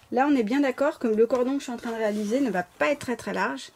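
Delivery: ordinary talk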